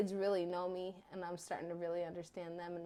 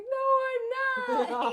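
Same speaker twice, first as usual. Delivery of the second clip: much higher, slow whiny denial near tears; teen daughter denying; 13 dB louder, much slower than usual. Retold or imitated. Mother imitating her teen daughter holding back tears, high and whiny. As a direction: squeak out a teary denial. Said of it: "And she's like".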